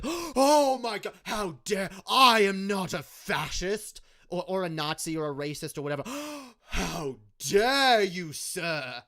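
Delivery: slow, hammed-up gasping offense; mock outrage. Mocking right-wingers, imitating a hammed-up offended gasp. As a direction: play the clutching-pearls victim, drawn out.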